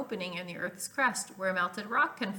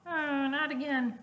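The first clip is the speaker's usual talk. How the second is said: put-on groaning kid; 6 semitones higher than usual. Arnold groans about styrofoam.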